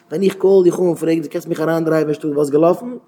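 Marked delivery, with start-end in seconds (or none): none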